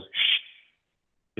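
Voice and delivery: put-on a little girl's shush quieting the grown-ups. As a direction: hush the room with a breathy shh. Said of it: "just shushes them".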